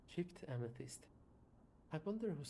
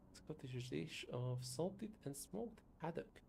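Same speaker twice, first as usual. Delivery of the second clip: ordinary talk, nothing marked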